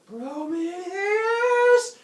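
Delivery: loud, high, long-drawn yell; enraged bellow. Acted. Tricked Zeus yells for Prometheus, furious bellow. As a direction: bellow the name in fury, stretching it out.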